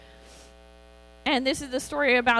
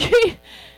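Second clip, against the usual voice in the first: burst of laughter; 13 semitones higher, 11 dB louder than usual. Real, not acted. Laughs at the crowd bringing no food.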